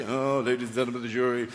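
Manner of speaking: lightly voiced barrister, pitched low; mock barrister. Barrister strolling before the jury, mock courtroom address. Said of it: "doing the walk in front of the jur".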